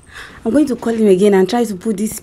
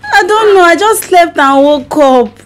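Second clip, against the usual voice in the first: puzzled stranger in someone's house; amnesiac confusion; 4 semitones higher than usual. Pretend. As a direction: sound lost and unsure. Wife fakes amnesia prank call.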